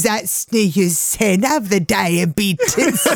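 put-on slow, drawn-out old lady lament; a lightly voiced old woman laughing through it. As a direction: moan it like a frail granny. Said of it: "I says".

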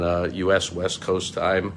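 ordinary talk

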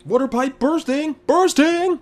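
mock alarm shout. Mock alarm over Chandler's outburst.

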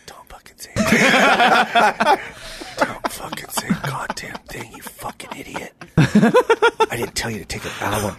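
whispered threat through the teeth; sister's furious whisper in the cop car; hissed whisper. Retold or imitated. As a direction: whisper it so the cops can't hear. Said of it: "My sister's just like this".

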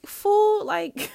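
high, loud scolding call; exasperated. Retold or imitated. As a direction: scold him in disbelief. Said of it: "And I'm like".

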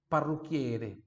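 ordinary delivery

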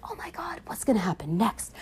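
hushed theater whisper; excited whisper. Retold or imitated. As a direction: whisper like a fan in the dark cinema. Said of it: "you were like".

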